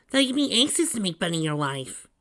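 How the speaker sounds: put-on high, loud teasing kid voice; joking kid sidekick. A narrator's reading. Tails nudges the nervous groom, joking.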